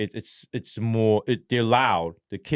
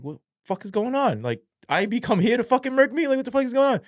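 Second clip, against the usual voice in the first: panicked; faster than usual. Retold or imitated. Panicked woken inmate.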